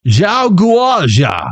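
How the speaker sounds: high, drawn-out angry mock-Chinese cry. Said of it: "in an angry Chinese voice".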